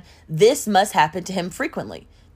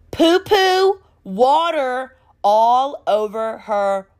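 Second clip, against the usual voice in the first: "poo-poo water" high and very slow; drawn-out emphasis; 5 semitones higher, 4 dB louder, much slower than usual. Retold or imitated. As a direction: stretch each word of "poo-poo water all over her", pitched up. Stressing the disgusting detail.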